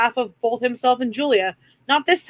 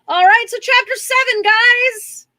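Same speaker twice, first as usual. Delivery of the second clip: excited announcement; 11 semitones higher, 4 dB louder than usual. Real, not acted. Loud cheerful restart.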